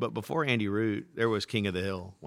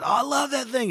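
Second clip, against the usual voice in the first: put-on very high, louder childish gush; gushing Bobby; 7 dB louder than usual. Son to dad, high gushing kid voice. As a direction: squeal fondly like a kid.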